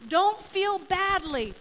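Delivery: high, loud, drawn-out appeal; Joseph reassuring his brothers. Acted. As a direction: plead it slowly and emphatically to your brothers. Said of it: "And Joseph goes".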